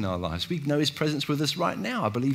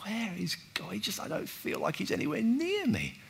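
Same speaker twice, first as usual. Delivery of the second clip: higher, softer, lost voice; a doubter quietly pleading, higher; plaintive doubt; 8 semitones higher, 8 dB quieter than usual. Retold or imitated. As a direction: ask it like someone abandoned. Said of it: "you just think".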